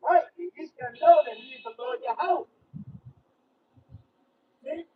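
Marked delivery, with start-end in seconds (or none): none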